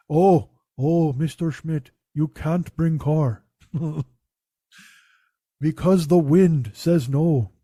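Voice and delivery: put-on slow, far-off spiritual old man; drawn out like a wise elder speaking to a customer; mystic mechanic voice. Mechanic voiced as a mystic, slowed and dreamy. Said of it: "as I'm delivering this voice".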